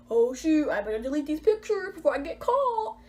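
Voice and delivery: high, rising whiny mock worry; exaggerated singsong oh-shoot panic. Mocking a thought she never had.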